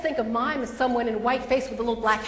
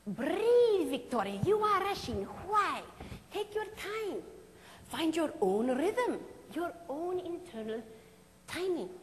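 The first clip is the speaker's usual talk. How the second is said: put-on higher, French-accented coaxing; Marcel Marceau voice; 7 semitones higher, 4 dB quieter than usual. Marceau coaching his rushing student in a French accent. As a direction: urge her, French mime master, to slow down. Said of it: "he would always say this to me".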